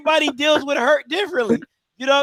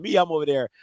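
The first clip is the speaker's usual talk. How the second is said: ordinary delivery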